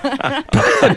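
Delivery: short high-pitched giggle; sudden amused burst; high laugh. Real, not acted. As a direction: break into a high laugh mid-question. Breaks up mid-question.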